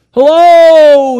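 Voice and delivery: high, loud drawn-out call; long desperate shout upward; crying out for help. Acted. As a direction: holler up a cliff for help. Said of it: "he cries".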